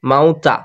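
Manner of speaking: মাউতা with a quick, unstretched "ta"; short final vowel. Shown as a demonstration. Teacher showing the class, quick final "ta" in মাউতা. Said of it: "ওই জন্য তাড়াতাড়ি পড়বো".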